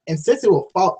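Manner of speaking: ordinary delivery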